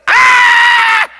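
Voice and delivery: huge high anguished yell of pain; piercing cry of agony; pained scream. Acted. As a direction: scream like a beam is stuck in your eye. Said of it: "what you would say if you have a beam sticking out of your eye".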